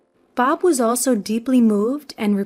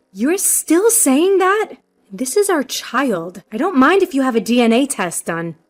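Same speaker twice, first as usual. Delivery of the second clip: exasperated, emphatic pushback; indignant protest; 3 semitones higher, 4 dB louder than usual. Acted. Wife protests the repeated accusation.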